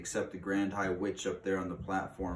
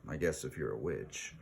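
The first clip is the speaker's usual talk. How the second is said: ordinary delivery, nothing marked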